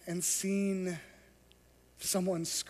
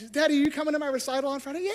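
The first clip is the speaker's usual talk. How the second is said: put-on higher, fast child's voice; daughter's voice; 6 semitones higher, much faster than usual. Daughter asking her father about the recital, high and quick. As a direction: play the eager little girl. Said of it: "You say".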